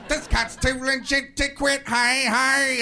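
high, slow, loud host voice; Robin Leach. Retold or imitated. TV host presenting a rapper, hammed-up impression.

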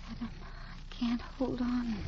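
soft, slow, drained whisper-like plea; fading weakness. Acted. Dying woman tells Adam she is slipping, soft and slow. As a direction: let the voice slip away.

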